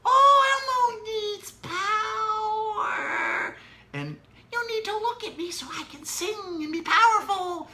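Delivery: very high squeaky Elmo, drawn out very slowly; a sad little puppet begging for power; silly Elmo voice. Shown as a demonstration. Parent voicing Elmo when the child looks away, very high and slow. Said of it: "you stop singing".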